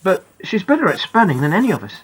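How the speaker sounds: ordinary delivery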